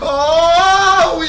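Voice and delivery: loud frightened cry; scared cry. Real, not acted.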